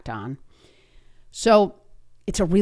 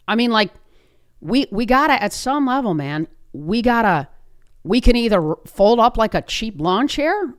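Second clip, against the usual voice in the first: higher, louder, emphatic challenge; urgent pep talk; 5 semitones higher, 4 dB louder than usual. Retold or imitated. Agent urges traded player to step up, emphatic pep talk. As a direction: press the point home like rallying a player.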